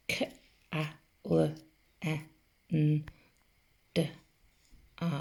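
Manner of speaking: each letter sound said separately with pauses; isolated phonics sounds, K A L E N D A, unhurried. Shown as a demonstration. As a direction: sound out K, A, L, E, N, D, A one by one. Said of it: "Let's sound it out".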